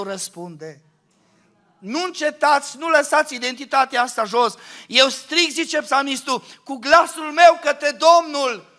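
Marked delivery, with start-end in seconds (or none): 1.8-8.7 loud, higher cry, slowed at the end; shouted exhortation. Acted. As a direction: cry it out to the Lord. Urging loud prayer, psalm quote.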